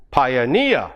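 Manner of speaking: "pioneer" as pio-NEER, pitch lifted on NEER; stress on the suffix. Shown as a demonstration. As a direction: hit the final syllable of "pioneer". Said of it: "normally on the suffix itself".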